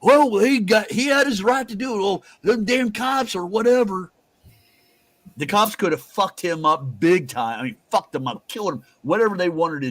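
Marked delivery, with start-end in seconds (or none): 0.0-4.1 lampoon him in a shrill, higher sneer; hammed-up scornful voice of a lunatic's defender; mocking impression. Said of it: "would fucking say".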